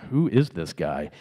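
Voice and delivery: low, slow, doubting question; mock suspicious aside; puzzled onlookers. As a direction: imitate a baffled crowd, voice dropped. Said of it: "people are like".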